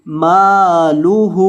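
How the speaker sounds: मालुहु slow, with both मा and हु stretched long; मालुहु with both vowels held long; long meem and ha. Shown as a demonstration. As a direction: draw out the मा and the हु in मालुहु. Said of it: "मीम को भी लंबा करेंगे और हा को भी लंबा करेंगे".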